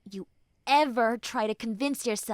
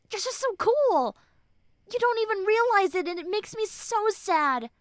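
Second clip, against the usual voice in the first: emotional burst; 8 semitones higher, 6 dB louder than usual. Acted. Emotional outburst of praise.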